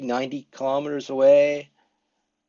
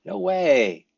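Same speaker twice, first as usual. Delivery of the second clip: casual surprise; 5 semitones higher, 4 dB louder than usual. Shown as a demonstration. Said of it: "If you feel really comfortable".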